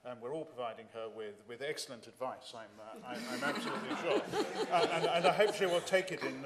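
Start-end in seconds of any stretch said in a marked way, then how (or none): 3.1-6.4 laughing loudly through the words. Real, not acted. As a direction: laugh while speaking up. MP laughs at many interventions.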